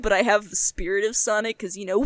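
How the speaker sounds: usual speaking voice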